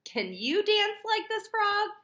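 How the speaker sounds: high, loud playful question; teacher rallying kids; excited invitation. Acted. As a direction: get them up and dancing.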